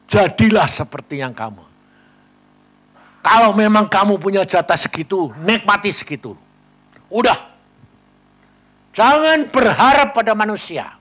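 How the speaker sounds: loud, high, forceful sermon exhortation; preaching at full force. Acted. Preacher exhorting the congregation, loud and forceful. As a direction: drive the point home to the flock.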